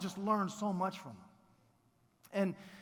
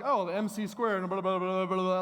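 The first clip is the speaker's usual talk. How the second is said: know-it-all rattling off a formula; suddenly smart Scarecrow; 5 dB louder than usual. Acted. Scarecrow showing off his new diploma, know-it-all gabble. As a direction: gabble it like a newly made genius.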